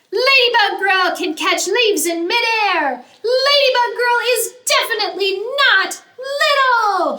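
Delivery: high, loud defiant yell; yelling into the wind. Acted. Lulu yelling her pride into the wind, loud high cry. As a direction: shout your defiance into the wind. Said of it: "she yells into the wind".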